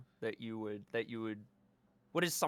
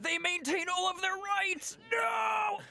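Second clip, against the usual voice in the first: high shouted mock cry; mock anguished wail. Friend wailing over the lost rights, high mock cry. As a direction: wail it like a melodrama.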